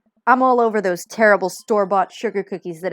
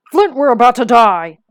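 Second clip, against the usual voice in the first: alarmed shout; 4 semitones higher, 11 dB louder than usual. Acted. Trucker yells at his brother.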